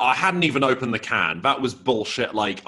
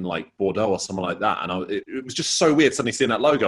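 normal talking voice

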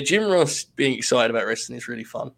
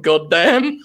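louder, higher mock commentator yell; retold outburst, raised voice; imitated yell; 4 semitones higher, 6 dB louder than usual. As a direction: exclaim it like an excited wrestling announcer. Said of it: "Jim Ross just yelled".

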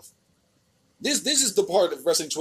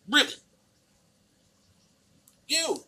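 ordinary delivery

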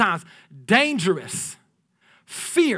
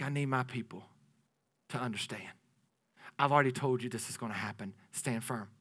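God speaking; 7 semitones lower, 12 dB quieter than usual. Retold or imitated. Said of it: "God's like".

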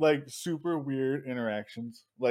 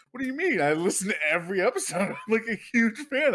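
high, fast gushing fan voice; excited fan; 7 semitones higher, faster than usual. Retold or imitated. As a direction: gush like a starstruck fan.

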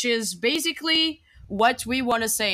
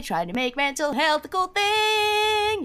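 high, slow mock tagline; mock slogan; 12 semitones higher, slower than usual. Co-host announcing the podcast pitch, mock slogan. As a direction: sell it like a cheesy podcast slogan.

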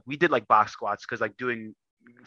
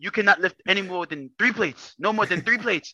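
put-on higher-pitched, insistent Kenyan accent; thick Kenyan accent; 6 semitones higher than usual. Grandfather setting a lifting limit, insistent Kenyan accent. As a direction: lay down the law as a Kenyan grandfather. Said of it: "Thick Kenyan accent".

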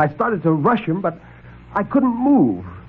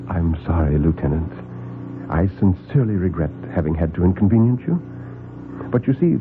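low, smooth, courteous villain; suave Doctor Grove; 7 semitones lower than usual. Acted. Host invites the trapped lieutenant in, smooth and low. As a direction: purr the politeness, lower than the narrator.

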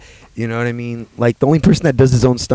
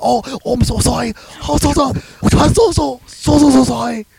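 high, repeated pleading sorry, much higher; mock Jackie Chan apology; 13 semitones higher than usual. Mimicking Jackie Chan's blooper apology, much higher voice. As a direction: squeak out frantic little apologies. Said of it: "he fucking punches somebody".

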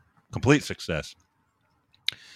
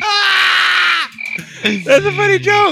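shout; 8 dB louder, much slower than usual. Real, not acted. Shouted after punchline.

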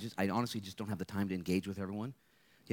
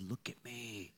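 tender quiet plea; 11 dB quieter, slower than usual. Acted. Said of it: "And Jesus said".

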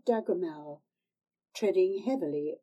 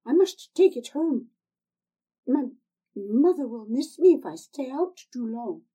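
higher and louder, anxious refusal; frightened girl; 6 semitones higher, 8 dB louder than usual. Acted. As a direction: pull back in alarm. Tanner refuses in horror.

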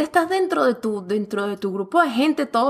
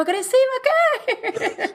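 much higher, slow, laughing disbelief; incredulous outburst; 12 semitones higher, slower than usual. Retold or imitated. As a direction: echo the word as if it were absurd, laughing. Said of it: "Y yo". (incredulidad aguda y lenta entre risas)

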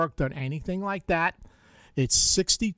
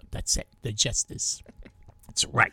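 whisper; 4 semitones lower than usual. Acted. Joking aside to co-host.

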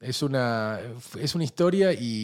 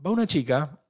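ordinary talk, nothing marked

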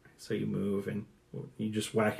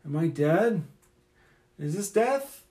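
higher, wondering voice; slow-waking bewilderment, lifting high at the end; dazed Galandro; 6 semitones higher, slower than usual. Acted. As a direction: ask the empty air, pitch rising. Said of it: "to nobody goes".

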